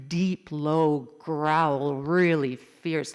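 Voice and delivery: low, loud, very drawn-out words; deep, fierce. A narrator's reading. Narrator describing the dog's growl, deep and fierce.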